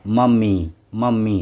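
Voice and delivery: मम्मी ending in a clear ee; final y as ee. Shown as a demonstration. Teacher models मम्मी for learners, final y as ee. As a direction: say मम्मी with y sounded as ee. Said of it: "वाई को ई की आवाज़ पढ़ेंगे".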